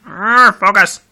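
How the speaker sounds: frustrated growl. Real, not acted. Phone camera won't focus.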